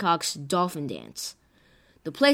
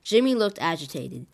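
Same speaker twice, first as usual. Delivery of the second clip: ordinary delivery, nothing marked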